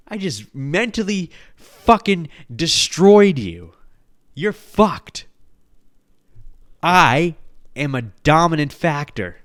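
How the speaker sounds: loud, slow, high boast; gloating triumph. Pretend. Four-year-old gloating over the adult he fooled, loud and slow. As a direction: crow over the beaten adult.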